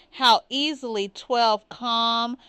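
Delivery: ordinary delivery, nothing marked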